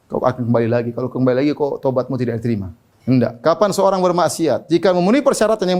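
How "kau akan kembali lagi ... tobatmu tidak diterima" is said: Satan tempting. Retold or imitated. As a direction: voice dropped low, coaxing like the devil. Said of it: "Setan terkadang datang, bilang sama manusia". (suara setan yang rendah dan membujuk)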